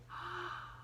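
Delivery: sharp gasp of an idea; sudden gasp. A narrator's reading. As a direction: catch your breath suddenly. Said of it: "Pollyanna stopped abruptly".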